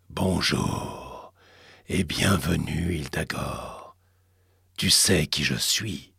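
deep, slow booming cosmic voice; cavernous entity voice. Acted. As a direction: speak like an ancient fire god. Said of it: "une voix caverneuse se fit entendre".

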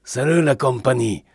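put-on lightly Spanish-accented hearty call. A narrator's reading. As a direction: hail the room with a touch of a Spanish accent. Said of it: "avec un accent qui dissimulait mal ses origines espagnoles".

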